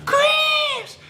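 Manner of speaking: very high mock-mom screech of a name; mom calling out. Mom calling Chris to help, imitated high-pitched yell. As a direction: imitate mom hollering through the house, drawn out in falsetto. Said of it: "all I hear my mom".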